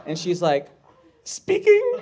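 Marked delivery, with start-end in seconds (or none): 1.2-2.0 very high, loud squeaky answer; Barbara. Retold or imitated. Hoarder woman answering the producer, very high mock voice. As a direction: shoot the voice way up, loudly.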